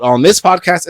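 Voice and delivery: ordinary talk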